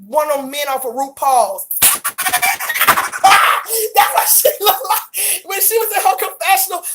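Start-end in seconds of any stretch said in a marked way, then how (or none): 1.8-3.9 high-pitched cackling laugh; laughing hard. Real, not acted. Cracking up after a mean looks joke, high cackle. 3.9-6.8 laughing. Real, not acted. Still laughing at her joke.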